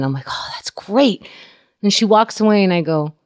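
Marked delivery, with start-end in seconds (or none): 0.3-1.2 whispered aside. Retold or imitated. Reacting to doctor.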